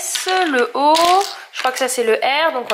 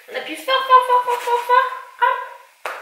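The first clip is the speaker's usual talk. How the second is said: sing-song encouragement; 11 semitones higher, slower than usual. Acted. Mother coaching child pressing dough.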